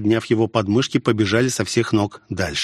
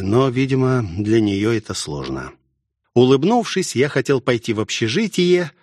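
ordinary delivery

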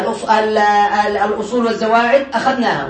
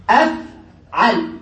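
أفعل split by a long pause; slow syllable split; 4 semitones lower, much slower than usual. Shown as a demonstration. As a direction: say أفعل very slowly, in two parts. يقطّع الوزن للطالب. (تقطيع أفعل ببطء)

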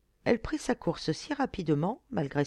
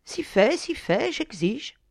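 high, louder insistence; insistent; 7 semitones higher, 5 dB louder, slower than usual. A narrator's reading. Young lord demanding that his rescuer name a wish, insistent. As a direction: press her, brooking no refusal.

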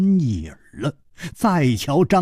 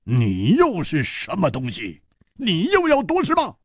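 indignant challenge climbing high; angry shout; 5 semitones higher than usual. Acted. Song Gang challenging the grinning newcomer, angry. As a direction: demand it angrily, rising sharply in pitch. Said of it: "怒喝道".

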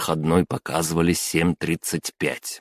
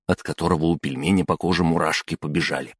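ordinary delivery, nothing marked

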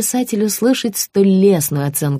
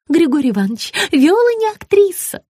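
high-pitched anxious gush; high sugary woman; 12 semitones higher, 3 dB louder than usual. A narrator's reading. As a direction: correct him nervously. Margarita's voice.

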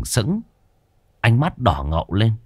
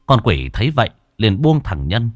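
plain reading voice

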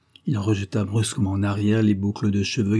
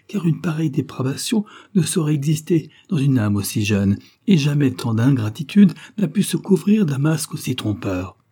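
higher, urgent defence with breathy whispered bursts; impassioned plea; 6 semitones higher, 3 dB louder than usual. A narrator's reading. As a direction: plead his innocence fervently. Daughter pleads for Salvatore. (plaidoyer fervent, plus aigu, avec des chuchotements)

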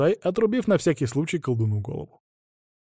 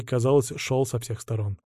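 plain reading voice

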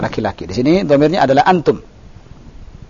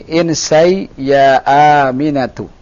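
"Insai ya Aminatu" slow, falling at the end; command intonation; slower than usual. Shown as a demonstration. Teacher correcting a student's question tune, command intonation. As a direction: draw out "Insai", drop on "Aminatu". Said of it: "Ini perintah".

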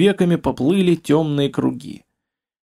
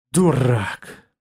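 disgusted. A narrator's reading. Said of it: "произнёс Олег с отвращением и отчаянием".

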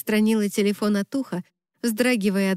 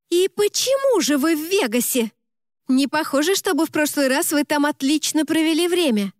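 high, indignant outburst; mock phone yell; 7 semitones higher than usual. A narrator's reading. Best friend hearing about Vegas on the phone, indignant high cry. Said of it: "так громко прокричала в трубку".

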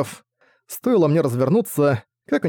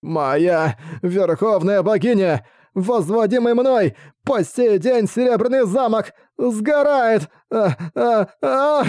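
high, slow lament rising to a scream; anguished wail; 8 semitones higher, slower than usual. Acted. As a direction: cry out in despair. King watches castle burn.

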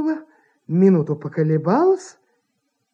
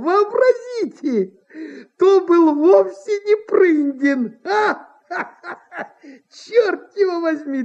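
put-on chuckling old impresario, pitch leaping high; relieved, giggling merriment in character; gleeful; 7 semitones higher than usual. A narrator's reading. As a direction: laugh heartily at his own mistake. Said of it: "сиял и потирал ... от удовольствия".

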